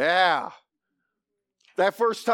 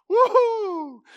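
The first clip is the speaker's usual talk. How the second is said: high, loud whoop of joy; preacher's high delighted whoop; joyful cheer; 13 semitones higher, 5 dB louder than usual. Real, not acted. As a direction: celebrate the coming king. Preacher cheers mid-reading.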